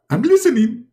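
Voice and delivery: high, eager listener; eager squeaky reply; Muhammad. Acted. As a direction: answer in a high comic voice. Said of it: "and Muhammad, he answer".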